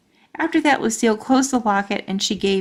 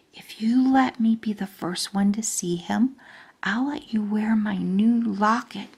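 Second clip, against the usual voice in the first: breathy whisper of a bribe; whispered secret. Acted. Lucille whispering a bribe into Junie B's ear, whispered. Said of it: "she whispered a secret in my ear".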